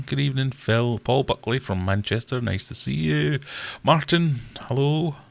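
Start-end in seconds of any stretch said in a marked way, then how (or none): none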